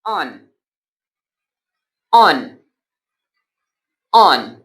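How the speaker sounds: teacher modelling on with a short o; on said slowly and clearly with a short open o; model word drill. Shown as a demonstration. Teacher drills the word for students, clear short o. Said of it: "तो पहला word हमारे पास है".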